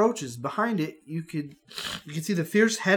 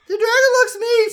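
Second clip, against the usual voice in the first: put-on very high, loud little-girl squeak; squeaky high kid voice; 10 dB louder than usual. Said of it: "Stacy says".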